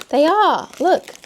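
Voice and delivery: high excited gasp; delighted gasp. Real, not acted. Gasps at soup block.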